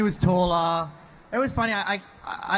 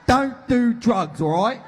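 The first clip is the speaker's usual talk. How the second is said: higher, louder preachy London uncle; hammed-up lecture to the kids, higher and louder; mock uncle lecture; 3 semitones higher, 5 dB louder than usual.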